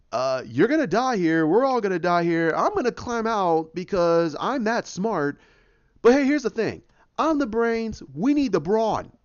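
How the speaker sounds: high, urgent acted pep talk; Rocket. Rocket urging trapped Hulk to lift, high urgent imitation. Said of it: "you have Rocket going".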